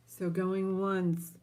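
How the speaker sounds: slow drawn-out auction call; auctioneer call. Real, not acted.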